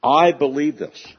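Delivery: I stressed high and loud, slowly; slow, weighty I believe this with I punched; emphatic I. Shown as a demonstration. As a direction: hit I hard in I believe this. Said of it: "It's a point of emphasis here".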